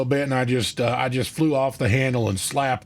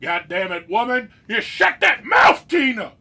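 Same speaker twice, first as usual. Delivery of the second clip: huge high screaming yell at his wife; husband roleplay, screaming at wife to shut up; screaming at the top of lungs; 10 semitones higher, 4 dB louder than usual. Pretend. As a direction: scream it as loud and high as you can. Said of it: "scream at the top of your lungs".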